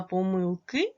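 "помилки" stressed on the final "ки"; last-syllable stress. Shown as a demonstration. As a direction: lift and stretch the "ки" ending "помилки". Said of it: "буде падати на останній склад". ("помилки" з наголосом на останній склад "ки")

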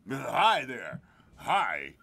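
stiff, dropping mock hello; a hint of a humourless star; imitating Shatner. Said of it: "This is what he would do".

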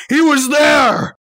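shout. Acted. Frantic retelling, shouting.

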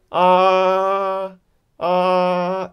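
AH held high and level; long, high, unbending AH. Shown as a demonstration. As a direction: sustain AH on one very high pitch. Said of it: "'cause it's a very high pitch".

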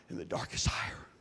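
hushed whisper; sermon close in a hush. Acted. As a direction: drop to a breath on the last words.

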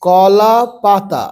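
কলা পাতা very slow, syllables stretched; slow, broken reading. Shown as a demonstration. Teacher showing beginners the first step, read slowly. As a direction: draw out কলা and পাতা separately. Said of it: "এবার তাড়াতাড়ি পড়বে".

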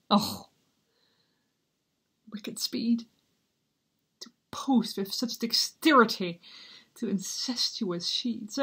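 grieving, bitter prince in torment; Hamlet soliloquy. Acted. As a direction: swing between hushed despair and outbursts.

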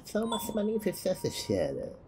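high falsetto parroting of a complainer; mocking mimicry.

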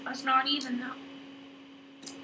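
talking around a lollipop; sucking a lollipop. Real, not acted. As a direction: lollipop in mouth while talking. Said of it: "he's sucking on a water, a lollipop".

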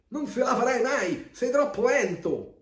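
high, loud, insistent yell; shouted warning. A narrator's reading. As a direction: yell it like worried friends in a story. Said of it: "gridano i suoi amici". (avvertimento gridato, acuto e forte)

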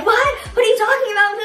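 imitating a panicked denial; mock defensive. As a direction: play guilty denial. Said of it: "I was gonna be so quick to respond".

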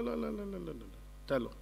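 soft, trailing string of refusals; gentle acted refusal. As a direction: brush it off very quietly, fading away. God turns down Michael's offer.

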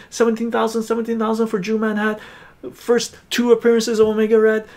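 fast, high auctioneer call. Acted. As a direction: rattle it off like an auctioneer.

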